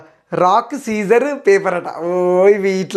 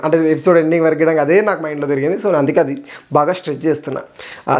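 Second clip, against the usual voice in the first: usual speaking voice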